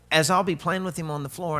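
ordinary talk, nothing marked

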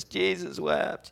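high, slow mocking recitation; smart-aleck quote. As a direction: break the silence with a drawn-out joke answer. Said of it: "and somebody goes".

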